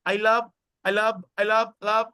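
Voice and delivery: I love repeated fast until the I vanishes; I love blurred together. Shown as a demonstration. Teacher imitating native speakers, I love slurred together. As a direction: run I into love until only love is heard. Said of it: "একসাথে বলতে বলতে".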